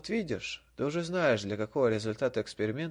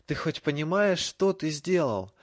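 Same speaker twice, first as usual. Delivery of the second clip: choked with rage, louder and slow; furious; 5 semitones higher, 6 dB louder than usual. A narrator's reading. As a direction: spit it out in fury. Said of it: "Драко задохнулся от ярости".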